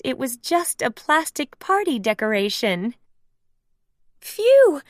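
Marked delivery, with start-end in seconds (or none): 4.2-4.8 high, loud sigh of relief; loud relieved phew. Acted. Mickey relieved the skeleton is fake. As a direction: blow out the fright.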